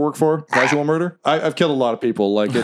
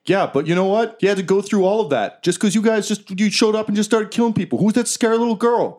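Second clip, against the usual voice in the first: a higher, pleading dwarf worker; 6 semitones higher than usual. Acted. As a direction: beg the intruders to leave you be. Dwarf complains about killing.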